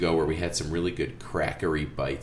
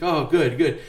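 put-on kid voice; 9 semitones higher, 6 dB louder than usual. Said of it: "He's like".

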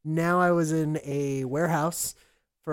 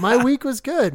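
laughing; 7 semitones higher, 6 dB louder than usual. Real, not acted. Laughing after shake joke.

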